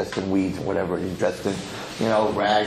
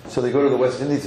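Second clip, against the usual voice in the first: plain reading voice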